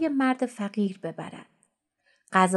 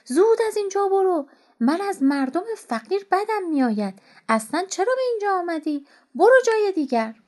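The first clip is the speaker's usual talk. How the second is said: much higher, louder angry yell; furious shout; 9 semitones higher, 4 dB louder than usual. A narrator's reading. Rich man roars at the poor beggar to leave. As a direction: chase him off with a roar. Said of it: "ناگهان مرد فریاد زد".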